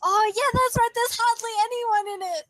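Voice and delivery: excited. Real, not acted. Excited reaction to recording pitch.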